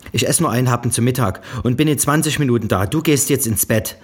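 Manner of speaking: höher, lauter und schnell, keine Widerrede; resolute Mutter. A narrator's reading. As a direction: rassle es bestimmend herunter. Mutter setzt sich durch. (Mutter kündigt an, gleich zu kommen)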